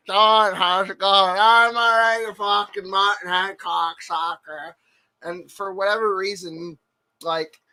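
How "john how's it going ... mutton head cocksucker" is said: lightly raised Brett greeting, slow start. Retold or imitated. Friends on the phone greet each other in Brett's voice, a light impression. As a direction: greet him slowly, then snap the insult. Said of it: "talking to each other in Brett's voice".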